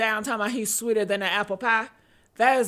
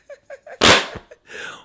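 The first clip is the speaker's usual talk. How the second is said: silent high breathy giggle; high wheezing laugh; 14 semitones higher, 5 dB louder than usual. Real, not acted. To viewers, high wheezing laugh. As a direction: laugh without voice, squeaking.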